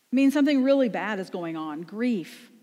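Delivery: normal talking voice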